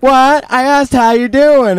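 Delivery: high, stretched-out call across distance; imitated mom hollering back. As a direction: shout a puzzled repeat to someone far away. Mom shouts back from afar.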